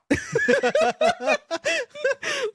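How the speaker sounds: real laughter, high and sustained; laughing at his imagined outburst; long high laugh. Real, not acted. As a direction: crack up at own joke. Laughs after own punchline.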